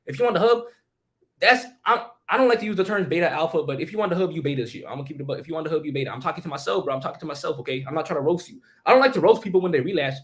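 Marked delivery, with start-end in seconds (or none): none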